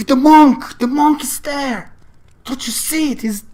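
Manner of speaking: high, loud, urgent insistence; desperate to make her see; insistent pleading. Acted. Andre begging his wife to see the monk, high and urgent.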